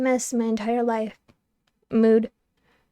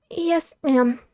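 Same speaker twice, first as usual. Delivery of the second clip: small meek squeaky yes ma'am; timid little squeak; mewling squeak; 3 semitones higher than usual. A narrator's reading. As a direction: squeak it out meekly. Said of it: "my mewling squeak".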